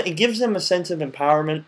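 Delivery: ordinary delivery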